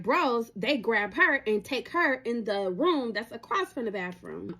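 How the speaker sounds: plain reading voice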